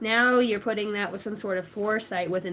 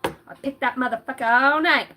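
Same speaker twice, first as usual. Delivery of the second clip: usual speaking voice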